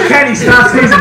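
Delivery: a hint of a fed-up teacher; annoyed teacher. Teacher telling Kenny to stop, mock annoyed. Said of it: "McGrath would be like".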